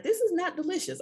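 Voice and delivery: put-on a small child pronouncing judgment in a high voice; daughter's verdict. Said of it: "She was like".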